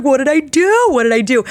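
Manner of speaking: high, loud panicked cry. Retold or imitated. Panic after eating the pepper.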